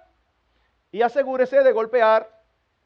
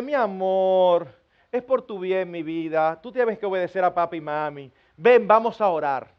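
tender consoling parent; 5 semitones lower than usual. Acted. Parent consoling a child after punishment, tender. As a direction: soothe a child lovingly after discipline.

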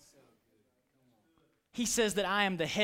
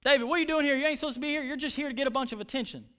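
acted rebuke; 6 semitones higher, much faster than usual. Oldest brother rebuking David for being there, high scolding. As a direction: tell him off in a raised, quickening voice. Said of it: "rebuked David".

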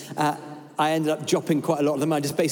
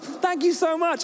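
much higher, slow, overflowing thanks; gushing thanks; 12 semitones higher, slower than usual. Retold or imitated. Preacher reading his email before editing, gushing and high. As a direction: sing out the thanks with exclamation marks. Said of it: "each sentence is like".

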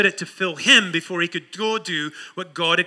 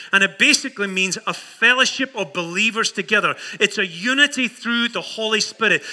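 usual speaking voice

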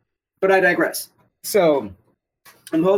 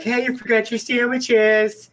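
raised-pitch cartoon soldier voice; GI Joe impression; 6 semitones higher than usual. GI Joe from the meme calls out forgotten sandwiches, put-on character voice. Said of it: "the G I Joe was like".

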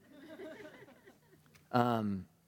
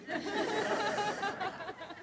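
high laugh; 4 dB quieter than usual. Real, not acted. Laughter after the handoff.

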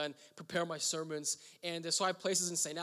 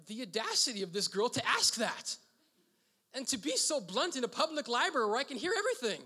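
indignant; 6 semitones higher, 3 dB louder than usual. Retold or imitated. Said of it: "I'm like".

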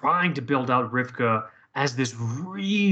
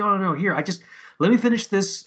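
higher-pitched imitated protest; retold flustered insistence; 5 semitones higher, 3 dB louder than usual. Retelling bereaved coworker refusing to leave, high and insistent. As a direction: wave them off in a raised voice.

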